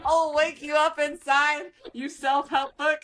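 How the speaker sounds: hammed-up threat to a calm spouse; taunting a calm spouse; mock taunt. Mock angry retort.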